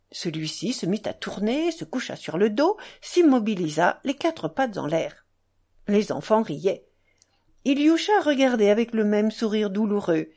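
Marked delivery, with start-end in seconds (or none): none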